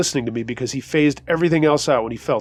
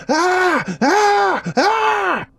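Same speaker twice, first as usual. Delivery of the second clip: three very high, drawn-out shrieks; imitated panicked screaming; 4 dB louder, much slower than usual. A narrator's reading. Narrator imitating coworker who saw the gun, high screams. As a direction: shriek repeatedly, imitating someone losing it. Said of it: "She started screaming at the top of her lungs".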